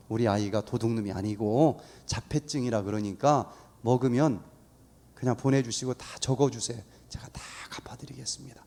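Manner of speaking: retold mother's plea, low and soft; softly pleading. Mother asking shop owners to let her son go, softly pleading.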